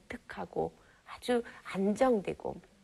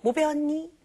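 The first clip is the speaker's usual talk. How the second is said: higher, louder quick parental question; mock eager parent; 6 semitones higher, 5 dB louder than usual. Parent questioning child after school, brisk. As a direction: quiz the kid the usual way. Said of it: "우리는".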